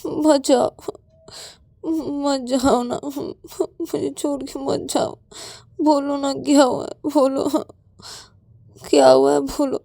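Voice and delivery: high, sobbing plea; tearful, halting entreaty; crying. Acted. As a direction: beg through sobs, words broken up. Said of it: "पायल रोते रोते बोलती कि".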